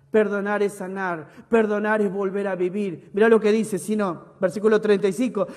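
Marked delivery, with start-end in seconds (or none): none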